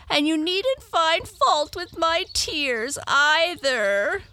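high, loud, slow wail; tearful Tearful. Acted. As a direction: drag out the protest, near tears. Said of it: "beginning to cry again".

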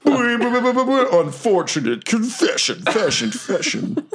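echo soundbite. Pretend.

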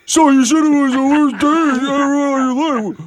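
Taz impression. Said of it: "do it in my voice".

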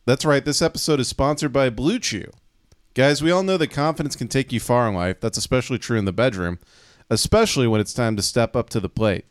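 upbeat sponsor read. Acted. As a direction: sell it like a radio ad. Podcast host reads sponsor ad.

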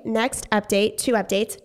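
ordinary talk, nothing marked

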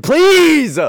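loud, high, drawn-out plea; exasperated cry. Real, not acted. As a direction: beg them loudly to stop, way up high. Begging groups to stop, hammed up.

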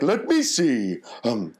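hammed-up Santa crooning, ending in a chuckle; Father Christmas voice. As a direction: drag it out like a jolly Santa. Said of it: "I was like".

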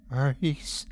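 soft, whimpering call; a faint, pitiful whimper. A narrator's reading. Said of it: "the mass whimpered".

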